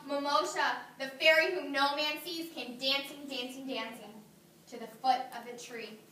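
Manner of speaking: normal talking voice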